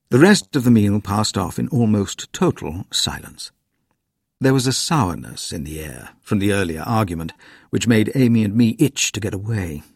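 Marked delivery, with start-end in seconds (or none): none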